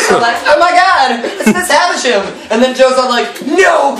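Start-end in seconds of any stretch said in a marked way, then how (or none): 0.5-2.3 high-pitched, gushing discovery; mock excitement. Pip guessing who his benefactor is, mock excited. 3.5-4.0 high, drawn-out protest; mock protest. Joe stopping Pip guessing, mock protest.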